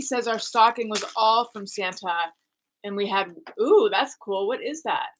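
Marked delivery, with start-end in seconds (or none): none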